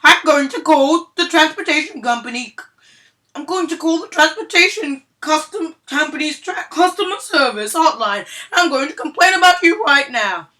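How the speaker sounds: high, loud, shrill-edged demanding mom; loud indignant Karen voice; entitled mom voice. Acted. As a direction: play the outraged entitled customer. Entitled mom threatens driver.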